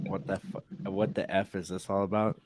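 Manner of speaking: low, very soft reading to himself; under his breath. Real, not acted. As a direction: read it quietly, half to yourself.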